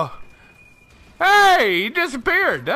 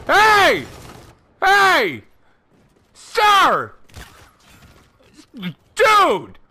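raised, higher-pitched exclamations; calling out; 4 semitones higher, 3 dB louder, much slower than usual. Real, not acted. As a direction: call after someone who ignores you. Calling out at other players.

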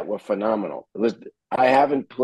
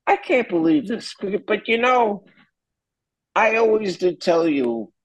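high, far-off imitation of dad; amazed father; 11 semitones higher than usual. Father marveling at his son's gig, high mimicking voice. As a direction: mimic the proud, stunned father. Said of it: "He goes".

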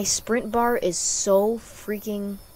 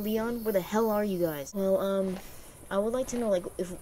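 ordinary delivery

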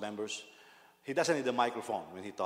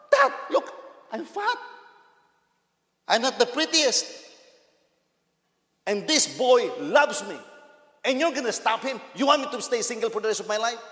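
put-on much higher, loud, cheeky girl's voice; laughing daughter; 10 semitones higher, 9 dB louder than usual. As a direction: laugh it off at dad, high and loud. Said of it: "She was laughing while telling".

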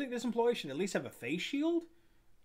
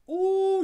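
high loud wince of pain; pained ooh; 9 dB louder than usual. Retold or imitated. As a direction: groan like your back aches. Remembering sore back from hammering.

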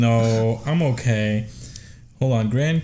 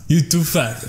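higher mock Russian verdict; Russian accent; 5 semitones higher than usual. Co-host mimicking the nurse, higher Russian accent. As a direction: echo it as a blunt Russian nurse.

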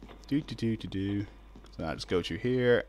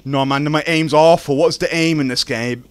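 loud frustration; 4 semitones higher, 10 dB louder than usual. Real, not acted. Frustrated at his aim.

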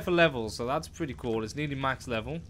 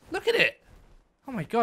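excited; 7 semitones higher, faster than usual. Real, not acted. Spots the creature running off.

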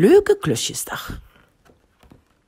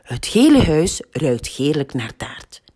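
ordinary delivery, nothing marked

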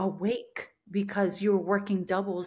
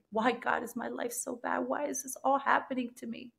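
soft, higher, pleading cry to God; despairing prayer; 4 semitones higher, 6 dB quieter than usual. Retold or imitated. In the back of the police car, softly pleading with God. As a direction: ask God why, half broken.

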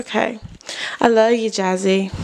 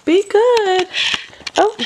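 pet talk; 9 semitones higher, slower than usual. Real, not acted. Saying goodbye to her dog.

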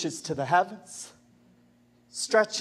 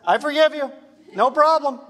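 higher, louder, bright "I forgive you"; eager forgiveness; 7 semitones higher, 6 dB louder than usual. Shown as a demonstration. Pastor showing passionate forgiveness, quick and joyful. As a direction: toss off "I forgive you. No problem" gladly. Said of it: "the more passion you have for Jesus, it's like this".

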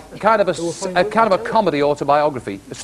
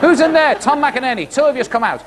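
acted holler into a building; calling out; 8 semitones higher than usual. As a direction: shout for them to come out, pitched up. Calling out Tom McInerney joke.

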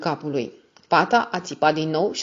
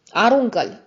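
firm, louder command; 5 semitones higher, 6 dB louder, slower than usual. Acted. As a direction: order him to drop the gun, no argument. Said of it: "cu voce fermă".

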